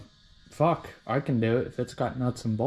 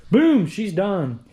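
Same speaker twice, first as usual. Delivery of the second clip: triumphant; 7 semitones higher, 7 dB louder, much slower than usual. Real, not acted. Finishing the job with a flourish.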